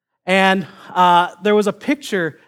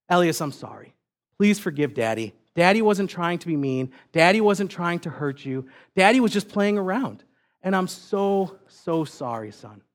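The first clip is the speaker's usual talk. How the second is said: soft, lowered apologetic voice; gentle apology; 4 dB quieter than usual. Retold or imitated. Dad to his offended little son, soft sincere apology. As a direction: plead softly for forgiveness, sinking quieter at the end.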